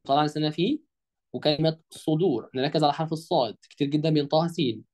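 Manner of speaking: normal talking voice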